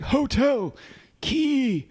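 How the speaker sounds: high, strained single-word bursts; mock breathless Foggy. Foggy warning Zach about Lainey, hammed-up breathless alarm. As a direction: imitate gasping out one word at a time. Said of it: "Foggy says very little. He's like".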